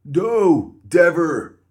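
loud, high, stuffed-nose refusal; broken-nose voice. Acted. Injured guard refusing to help Molly, blocked-nose yell.

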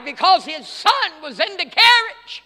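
ordinary talk

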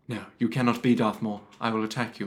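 low, measured mock Jedi; Qui-Gon Jinn. Jedi confronting his foe, low-voiced film impression. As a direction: play a Jedi challenging, pitched well down. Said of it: "this is my best Qui-Gon Jinn".

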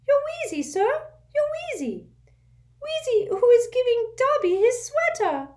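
urgent little servant squeaking; an anxious house elf waking his master; squeaky house elf. A narrator's reading. Dobby voiced.

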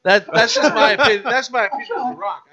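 loud laughing protest; laughing while insisting. Real, not acted. Laughing off his rant.

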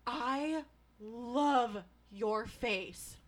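put-on slow, loud, gushing drunk compliment; drunk girl gushing. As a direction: gush it out like a tipsy stranger.

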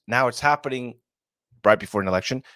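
ordinary talk

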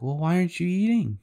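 put-on high little-kid question, lilting upward; high-pitched childish query; puzzled child. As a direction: imitate a kid innocently asking mom. Child questions her mother at table.

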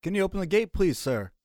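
polite request. Acted. Asking the guard politely.

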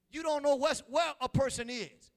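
loud, high preacher's cry; preaching at full force. Acted. Preacher rising up before the congregation, loud and high. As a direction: lift the congregation with a shout.

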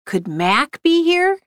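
wondering question. A narrator's reading. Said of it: "Serge asked the question".

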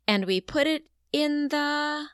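high, slow lead-in stopping before the word; cue pause. Shown as a demonstration. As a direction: leave the sentence hanging for the child. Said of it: "and I pause".

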